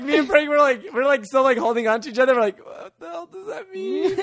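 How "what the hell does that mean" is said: high, soft, slow disbelief; baffled squeak. Retold or imitated. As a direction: squeak it quietly, totally lost. Baffled whisper between friends.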